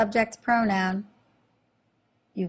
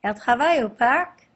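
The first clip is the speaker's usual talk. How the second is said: "Elle travaille au parc?" rising at the end; question intonation; 4 semitones higher, 5 dB louder than usual. Shown as a demonstration. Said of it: "you simply change your voice".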